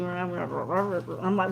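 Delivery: normal talking voice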